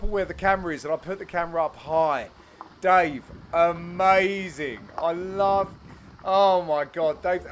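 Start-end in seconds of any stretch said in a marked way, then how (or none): none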